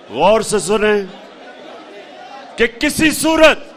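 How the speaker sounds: ordinary talk, nothing marked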